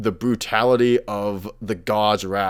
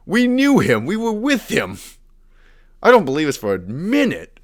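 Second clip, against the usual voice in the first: high-pitched mock outrage, louder; mock indignant princes; 11 semitones higher, 3 dB louder than usual. Elven princes protesting to Malekith, high mock outrage. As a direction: squeal your disbelief like offended elven princes. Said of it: "They're like".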